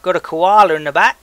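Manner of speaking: loud, high, jokey Aussie boast; hammed-up Australian accent. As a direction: brag like a cartoon Aussie, loud and bold. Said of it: "very shitty Australian accent".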